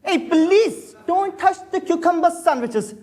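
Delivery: high alarmed call to a greedy friend; sharp call out. Acted. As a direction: stop him before he grabs a sandwich. Stops friend grabbing sandwiches.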